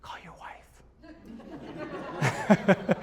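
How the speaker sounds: drawn-out whisper; whispered inner voice. Retold or imitated. Voice in dad's head urging him to phone, whispered. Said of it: "hear a voice in my head saying".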